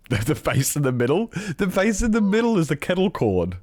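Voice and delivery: high, amused pointing out the joke; laughing at the meme, pitch way up; amused disbelief. Real, not acted. Streamer laughing at a meme to chat, amused and high.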